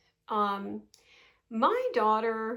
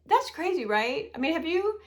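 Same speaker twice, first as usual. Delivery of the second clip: ordinary talk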